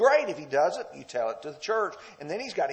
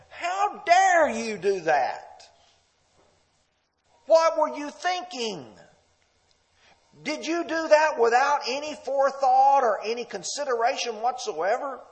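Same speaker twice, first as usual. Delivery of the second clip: confrontational; 7 semitones higher, 4 dB louder than usual. Retold or imitated. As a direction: scold them like a shocked elder. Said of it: "It's confrontational".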